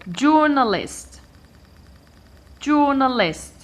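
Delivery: journalist with a high, strong "jour"; stress on first syllable. Shown as a demonstration. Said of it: "I stress the third syllable".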